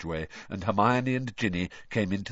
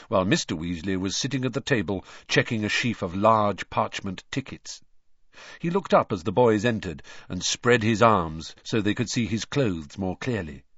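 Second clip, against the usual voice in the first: usual speaking voice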